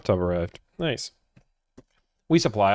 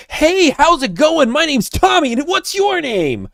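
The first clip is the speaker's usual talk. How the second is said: very high, slow, bubbly phone greeting; over-the-top cheery; 7 dB louder, slower than usual. Retold or imitated. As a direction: overdo the chipper phone hello. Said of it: "people don't talk on the phone like".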